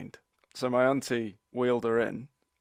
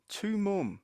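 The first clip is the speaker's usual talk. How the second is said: raised pitch, talking down to her; mock patronising; 9 semitones higher than usual. Cousin reads cards to blind grandmother, patronising and higher. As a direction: read it out as if to a small child. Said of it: "in the most patronising way possible".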